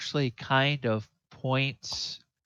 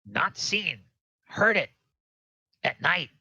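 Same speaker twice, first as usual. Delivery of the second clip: halting goblin voice, pitched up; goblin prisoner; 4 semitones higher than usual. Acted. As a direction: play a simple goblin in broken phrases.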